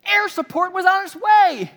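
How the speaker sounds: very high, full-volume outraged shout; yelling at the TV. Acted. Viewer scolding the dead movie hero, yelling. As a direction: holler in disbelief at the screen. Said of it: "we're still yelling at the T V".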